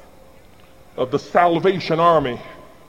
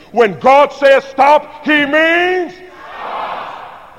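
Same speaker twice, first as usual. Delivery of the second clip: slow, stretched shout, much higher; booming call; 6 semitones higher, much slower than usual. Shown as a demonstration. Preacher leading the congregation in a shouted refrain, booming. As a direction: boom out stop like a command. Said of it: "can you do better than this side".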